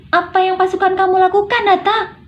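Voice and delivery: high-pitched, furious yell; angry shout. Shown as a demonstration. Demanding Nata explain what the troops did, yelling angrily. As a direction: shout the question in anger. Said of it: "berteriak marah".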